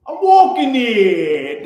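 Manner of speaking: slow, loud New York street shout; New York accent. Acted. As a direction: yell it like a Queens cabbie. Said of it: "New York accent".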